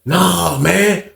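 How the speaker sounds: plain reading voice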